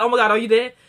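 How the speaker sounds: loud, mock-panicked outburst; mock freak-out. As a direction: blurt it out loudly, imitating someone freaking out. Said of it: "he starts freaking out".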